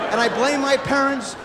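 ordinary delivery

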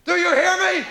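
high, loud scolding mother; shrill mom demanding an answer; angry mother. Retold or imitated. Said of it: "scream at you for fifteen minutes and then she says".

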